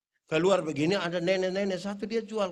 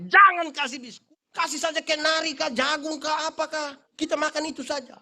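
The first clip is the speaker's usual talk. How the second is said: higher, firmer insistence; insisting; 7 semitones higher, 3 dB louder than usual. Retold or imitated. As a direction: press the point on the villagers. (pendeta bersikeras kepada warga)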